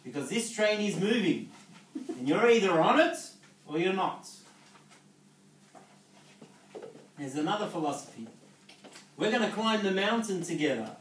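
like a coach firing up his team; full preaching mode. Acted. Speaker rallying distributors with his philosophy, loud and fervent.

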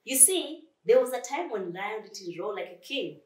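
plain reading voice

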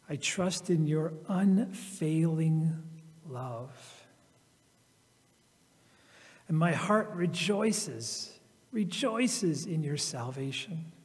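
slow, reflective reading with long pauses; slow scripture reading. Acted. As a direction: let each line sink in like a prayer.

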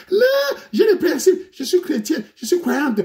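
high teary mock-virtuous voice; mock pious whine. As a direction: parody a prim girl claiming principles. Mocking a pious woman's excuse.

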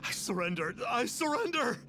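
high, frantic pleading for mercy; panicked begging. Acted. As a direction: beg for your life, terrified. Begging Xiao to spare him.

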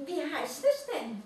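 high, eager little creature voice; excited. A narrator's reading. Mole rat asks the Christkind eagerly, high and excited. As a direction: ask it bursting with curiosity. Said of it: "rief der Nacktmull aufgeregt".

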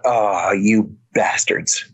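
low, slow, disgusted under the breath; a low muttered oath; muttered curse. Retold or imitated. Inner curse at FBI visit.

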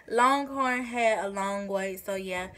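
ordinary talk, nothing marked

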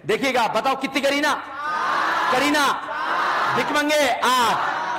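high, ringing quiz prompt; classroom drill call. Real, not acted. Teacher prompting students to count, in a high ringing call. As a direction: call it out and stretch the answer.

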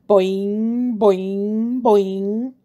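springy bouncing boings. A narrator's reading. Bouncing ball sound effect.

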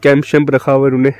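ordinary talk, nothing marked

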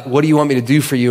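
fast, tossed-off quote; quick retelling. Retold or imitated. Retelling Jesus asking the blind man, fast. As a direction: rattle the question off quickly.

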